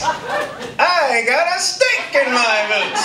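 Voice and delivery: high, slow talking-toy voice; Woody. Retold or imitated. Doll speaks in the man's hands, high slow drawl. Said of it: "I grabbed Woody out".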